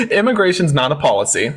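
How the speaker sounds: ordinary delivery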